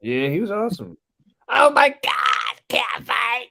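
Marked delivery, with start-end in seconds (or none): 1.5-3.5 high, drawn-out commentator scream; Joey Styles. Retold or imitated. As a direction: badly imitate an over-the-top announcer's shout. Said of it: "Sorry, I can't do a good impression".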